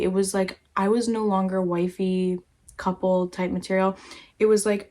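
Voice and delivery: ordinary talk, nothing marked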